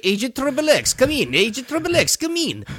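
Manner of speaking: high, hammed-up bad Russian accent on a radio call. Radio speaker calls the agent in a bad Russian accent. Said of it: "the bad English Russian accent".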